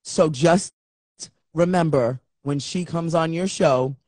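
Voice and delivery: low, measured man's voice, long pauses; mock-serious low mentor voice; imitated solemn advice. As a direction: warn gravely, phrase by phrase.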